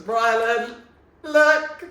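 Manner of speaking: high, loud, slow thrilled reading; excited. Real, not acted. Reading a headline about himself, thrilled and loud. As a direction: shout it out to show everyone.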